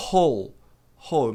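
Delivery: "Hole" with breathy opening H; short "Hole", H instead of English R; H pronunciation. Shown as a demonstration. Teacher demonstrates the confusion, clear H onset. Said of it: "é exatamente essa pronúncia".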